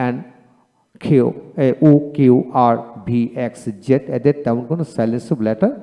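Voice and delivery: ordinary talk, nothing marked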